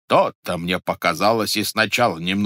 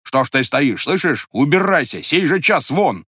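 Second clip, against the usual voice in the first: angry shout; 5 semitones higher, 5 dB louder than usual. Acted. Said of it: "сердито закричал Вакула".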